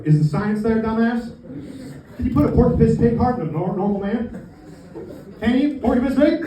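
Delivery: high, loud, incredulous comic rant; mock indignation. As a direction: fire it back in hammed-up outrage. Acted indignant retort to organ donor question.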